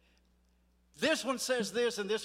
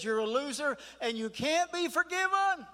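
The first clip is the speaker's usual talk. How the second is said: building to a high, loud mock accusation; 7 semitones higher, 4 dB louder than usual. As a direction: imitate an accuser, voice climbing to a shout. Mimics the enemy's accusation, building.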